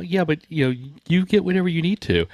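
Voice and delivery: imitating his own overly polite reply; high polite reassurance. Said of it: "And I'm like".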